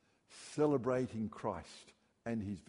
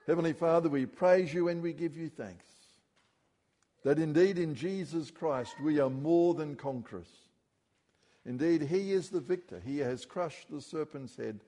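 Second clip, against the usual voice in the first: raised, earnest prayer to the Father; 3 semitones higher, 3 dB louder than usual. Acted. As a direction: lead the congregation in prayer. Closing prayer.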